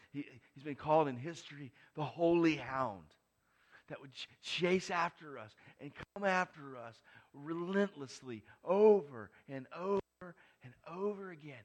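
loud, driving preacher's build; preaching at full force. Acted.

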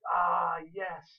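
loud, thrilled exclamation; pumped kid. Acted. Boy allowed to drive the cart, pumped. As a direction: celebrate like a kid given permission. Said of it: "he was pumped".

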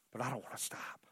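soft breathy whisper. Real, not acted. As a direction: drop to a whisper. Hushed personal resolve mid-sermon.

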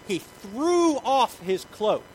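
high, loud, stretched-out verb; energetic outburst. A narrator's reading. Preacher showing how Bartimaeus acts, explosive. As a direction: fling the words out. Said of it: "listen to the words that the Bible uses".

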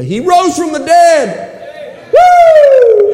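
slow high shout ending in a woo; preaching shout. Real, not acted. Preacher to the congregation, triumphant slow shout.

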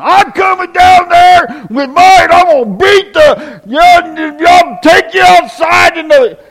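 high, loud furious shout; angry threat. Retold or imitated. Hothead calls to threaten the repair people, shouting. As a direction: bellow the threat like you're storming over. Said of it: "if you call and say".

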